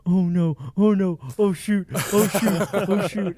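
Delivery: loud, high, repeated panicky cries; mock panic. Supervisor fearing a bad performance review, hammed-up panic. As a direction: imitate someone dreading bad news. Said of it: "And he's like".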